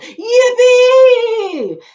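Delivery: very high, very loud joyful whoop; yell. Acted. Grandpa Joe whoops for joy, very high and loud. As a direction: explode with joy.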